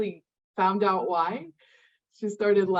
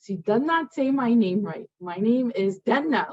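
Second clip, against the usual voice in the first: ordinary talk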